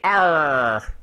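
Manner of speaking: hammy pirate arr; pirate growl. Shown as a demonstration. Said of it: "Talk Like a Pirate Day".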